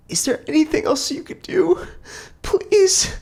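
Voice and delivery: very high, loud tearful plea; a crying mother begs the paramedic; desperate pleading mother. A narrator's reading. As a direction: beg through tears.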